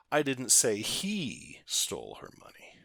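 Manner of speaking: "he" stretched out with a high falling tone; single out "he" as the important word; stress on HE. Shown as a demonstration. Teacher showing it wasn't by him, stress on "he". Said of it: "But what about this?".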